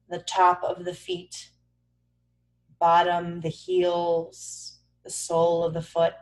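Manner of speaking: soft, low meditation voice with long pauses; calm, even body-scan narration; guided meditation. Acted. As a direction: guide them gently into stillness.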